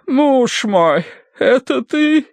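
high, faint lady's voice; weak voice. A narrator's reading. Wife comes round and asks for husband, weakly. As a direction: ask feebly, as if just revived. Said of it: "слабым голосом проговорила она".

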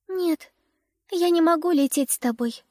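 put-on high, gentle little girl refusing. A narrator's reading. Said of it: "сказала она ласточке".

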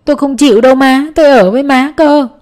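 put-on a child begging not to be taken, crying; tearful plea. A narrator's reading. Son begs his mother to keep him, tearful. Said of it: "cũng chảy nước mắt rồi nói rằng".

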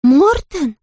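high, emphatic astonished cry; big high-pitched surprise; chorus of exclamation. A narrator's reading. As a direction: exclaim it as a whole chorus of children would. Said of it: "воскликнули все хором".

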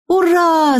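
put-on very high child's hurrah; cheering girl. A narrator's reading. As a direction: cheer high, without real shouting. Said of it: "закричала она". (высокое детское «ура»)

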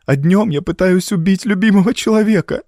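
high, distressed woman's voice; anguished confession. A narrator's reading. Evelyn fears harming her husband, high and anguished. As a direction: lift the pitch in dismay.

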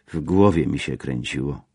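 ordinary delivery, nothing marked

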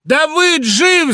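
very loud, high, drawn-out cry; shout. A narrator's reading. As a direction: shout the news at the top of your voice. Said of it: "закричал я громче прежнего". (громкий крик)

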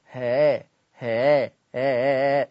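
ها softened, the hamza gliding toward alef; eased hamza sound. Shown as a demonstration. Teacher drills the eased hamza sound. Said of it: "سامعين الصوت؟".

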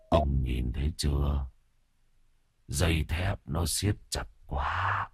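soft, low headless ghost voice; a dead servant moaning; ghostly moan. Acted.

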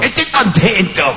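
1930s cartoon. Shown as a demonstration. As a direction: drawl it like an old cartoon. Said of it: "con voz de dibujo animado". (voz lenta de dibujo animado)

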